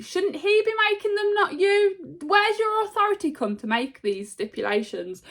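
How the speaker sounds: high put-on voice for Seth, needling; high mock voice, mocking the Rock. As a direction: squeak out the challenge in a mock Seth voice.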